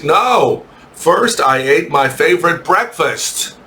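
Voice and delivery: measured classroom reading voice; teacher reading aloud. Acted. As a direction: read it out phrase by phrase, pausing between items. Reads student text aloud to class.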